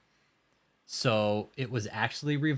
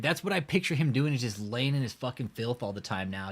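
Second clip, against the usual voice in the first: usual speaking voice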